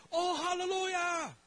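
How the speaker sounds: high, loud and slow cry of Oh, hallelujah; joyful shout. Retold or imitated. Pastors cry out in praise, loud ecstatic shout. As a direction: throw it up to the rafters.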